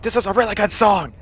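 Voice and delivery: retold excitement. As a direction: act out being thrilled by the song. Retelling own excited concert reaction.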